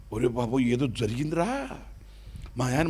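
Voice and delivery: low, soft anxious telling; quietly afraid something happened; fearful wife. Retold or imitated. Wife telling her son her fear, low and soft.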